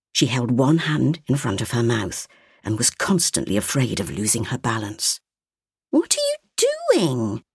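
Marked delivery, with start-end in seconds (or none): 5.9-7.4 put-on high, slow small-child voice; a curious little girl asking; little girl's voice. A narrator's reading. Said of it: "asked little Sophia".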